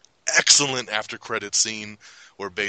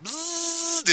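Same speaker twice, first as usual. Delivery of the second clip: high mock buzz; buzzing noise. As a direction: buzz like a zipping machine. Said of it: "he's just like".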